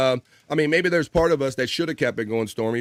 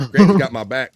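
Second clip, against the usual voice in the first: louder, punchy shout-out to Granny; loud cheer; 8 dB louder than usual. Real, not acted. Host cheering Granny's support in the chat, loud shout. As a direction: belt out Granny's got my back.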